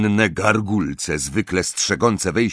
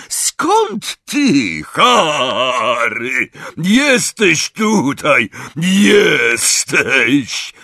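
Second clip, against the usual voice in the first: high, loud, drawn-out booming welcome; big excited giant's greeting; overjoyed shouting; 6 semitones higher, 4 dB louder, much slower than usual. A narrator's reading. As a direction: bellow with joy at finding a friend. Hagrid joyfully greets Harry.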